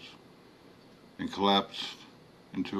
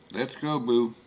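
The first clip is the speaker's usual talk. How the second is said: Creole woman; 4 semitones higher, 3 dB louder than usual. A narrator's reading. Philomene voiced by narrator.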